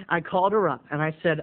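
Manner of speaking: ordinary talk, nothing marked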